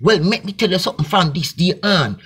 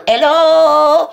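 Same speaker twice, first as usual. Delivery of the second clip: a high, loud hello?; mock call-out; 8 semitones higher, 5 dB louder than usual. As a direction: shout it out to the crowd. Calling out mockingly.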